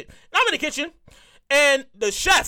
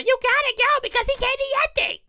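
higher, nagging mom voice; a hint of nagging mother; 9 semitones higher than usual. Mother pushing her son to the dinner, high nagging. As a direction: nag him to go. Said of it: "I can hear my mother".

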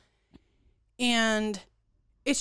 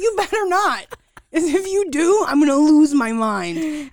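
higher, louder playful warning; mock threat; 6 semitones higher, 7 dB louder than usual. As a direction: warn the sister half-seriously. Retold warning to sister.

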